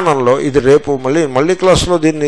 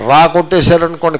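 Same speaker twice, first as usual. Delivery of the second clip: ordinary talk, nothing marked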